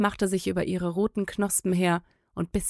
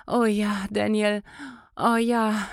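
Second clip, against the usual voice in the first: moaning; 3 semitones higher, slower than usual. A narrator's reading. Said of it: "stöhnte Clarissa wieder".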